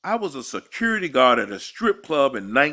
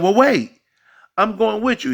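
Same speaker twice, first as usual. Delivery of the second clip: pleading woman; 9 semitones higher, 4 dB louder than usual. Retold or imitated. Retold woman pleading to come.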